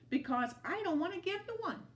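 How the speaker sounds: fast, high cheeky retort; lizard comeback. A narrator's reading. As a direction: toss it back quickly and cheekily.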